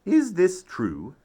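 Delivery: very mad mother. Acted. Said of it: "only calls me young man if she's very, very mad".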